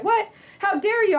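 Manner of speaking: high, loud indignant cry; mock outrage. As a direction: act shocked and offended. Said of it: "I was like".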